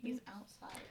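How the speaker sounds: whispered aside; hushed so dad can't hear. Real, not acted. Hiding from dad at door.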